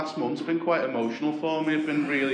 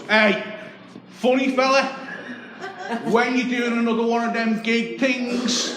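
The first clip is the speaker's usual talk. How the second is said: high and loud Scouse yell out the window; shouting taxi driver; 11 semitones higher, 5 dB louder than usual. Retold or imitated. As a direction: call out from the cab across the street. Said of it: "the driver leans out and he goes".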